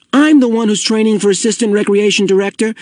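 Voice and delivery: put-on high, indignant boyish protest; angry. A narrator's reading. Boy defending his training to his friend, angrily. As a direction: assert your authority, cross and high-pitched. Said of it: "Asher pointed out angrily".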